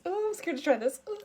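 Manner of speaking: high breathy mock fear; hammed-up nervous influencer; fake scared. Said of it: "parodying influencer".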